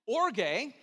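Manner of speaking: "Orge" two syllables, OR stressed. Shown as a demonstration. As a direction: model "Orge" for the room. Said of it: "Can you say that?".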